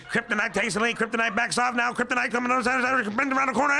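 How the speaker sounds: lightly voiced race caller, rising; horse racing announcer. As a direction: call the race as it builds. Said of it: "That's a horse racing announcer".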